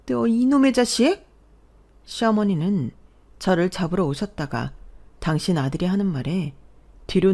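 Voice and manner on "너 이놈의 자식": high, loud outburst at her son; furious scolding. Acted. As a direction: lash out at the ungrateful son. (버럭 호통치는 엄마)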